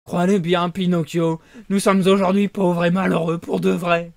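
loud, pleading sly fox; whining fox. Acted. Fox pleading with Pinocchio, loud and whining. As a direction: overplay the poverty to win pity.

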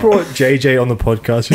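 ordinary delivery

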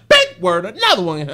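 very loud mock-proud big word; loud playful boast. Real, not acted. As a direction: crow over the fancy word. Announces his own fancy word.

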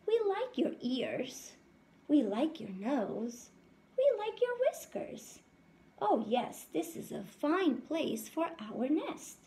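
a lightly voiced high buzzy bee chorus; sing-song them like happy bees; bees' voice. Bees tell the mouse they love his head, lightly high.

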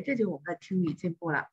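ordinary delivery

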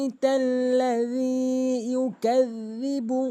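slow melodic tajweed chant; slow sung recitation; Quranic recitation. Shown as a demonstration. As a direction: recite the verse slowly, correctly. Recites corrected verse.

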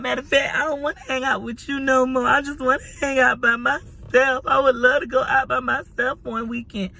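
whiny pleading wife. Retold or imitated. Wife retells whining at her husband about his friends. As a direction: whine at him like a neglected wife. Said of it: "I was like".

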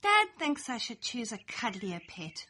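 usual speaking voice

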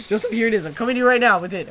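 high, loud, exasperated; exasperated calling. Real, not acted. Player bringing items to upset friend, exasperated shout. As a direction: raise your voice to placate impatiently.

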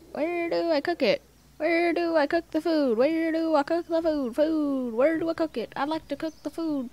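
mock pleading. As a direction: beg over and over in a high voice. Pleading repeatedly with the game.

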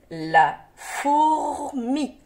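"La fourmi" very slow, la - four - mi, R held; sounded out. Shown as a demonstration. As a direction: stretch "fourmi" with a long R before "mi". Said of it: "Attention au R".

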